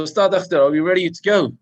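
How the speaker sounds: ordinary talk, nothing marked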